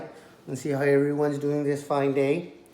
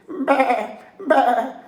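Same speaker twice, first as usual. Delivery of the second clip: high, louder bleating cry; lamb bleat; 10 semitones higher, 7 dB louder than usual. Acted. Lamb bleats at the coyote, high bleat. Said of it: "Borreguita bleated".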